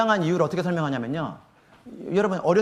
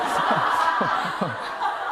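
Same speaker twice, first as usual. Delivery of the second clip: breathy laugh. Real, not acted. Laughing at himself.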